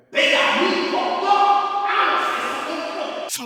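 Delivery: high, loud, slow strained cry of fury; raging at organisers who revoked his credentials; strained outraged yell. Retold or imitated. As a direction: let the anger burst out in a strained rasp. Outraged vow to speak out.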